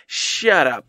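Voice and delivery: ordinary talk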